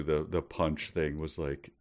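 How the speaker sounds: ordinary delivery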